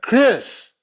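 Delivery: high, loud call across the lobby; calling out a name. Retold or imitated. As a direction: call his name out to catch him. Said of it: "she calls out".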